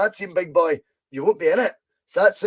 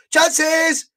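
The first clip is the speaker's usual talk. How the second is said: high loud shout at a chance; sudden shout of Chances; excited shout; 10 semitones higher, 7 dB louder than usual. Real, not acted. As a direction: yell it as the ball flies. Shot on goal, commentator shouts.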